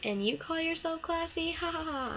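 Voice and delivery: high-pitched mocking retort with fake laugh; imitated mockery. Retelling a girl's mocking message.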